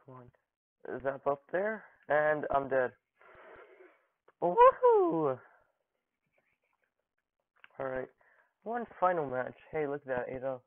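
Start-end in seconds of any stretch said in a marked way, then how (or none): 4.4-5.4 loud, very high "woohoo"; two-part falsetto-high cheer; high whoop. Real, not acted. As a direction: let out a long high whoop. Whoop after match ends.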